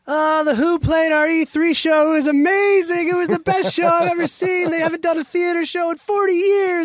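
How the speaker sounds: gushing mock email, very high and loud; Mike Dornbrook. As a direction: gush breathlessly, imitating a clueless boss. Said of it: "Dornbrook sends this email to the company like".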